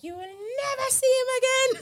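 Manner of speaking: mother's voice pitched high and shrill; high mock mom voice. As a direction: play the strict mother, way up high. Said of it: "She was like".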